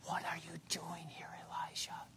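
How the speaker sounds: very soft whisper; still small voice. Acted. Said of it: "But in a still small voice".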